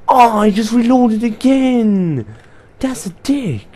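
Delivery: long, high, drawn-out shout of protest; slow, high-pitched howl of complaint; exasperated yell. Real, not acted. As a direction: wail loudly in frustration at the game. Exasperated at losing after reloading.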